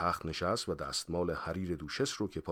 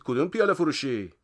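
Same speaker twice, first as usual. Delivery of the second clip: high, raised-voice check; shouted question; 8 semitones higher, 6 dB louder than usual. A narrator's reading. Friend quizzes departing man about the tavern, shouting. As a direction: call out the follow-up question.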